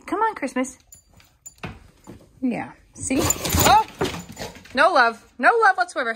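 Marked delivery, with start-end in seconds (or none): 3.2-6.1 loud, high startled "Oh!" then mock dismay; surprised outcry. Real, not acted. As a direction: react loudly as the cat swipes the dog. Cat swats the dog.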